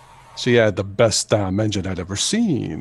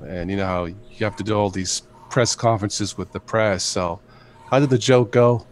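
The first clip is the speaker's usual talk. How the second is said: ordinary delivery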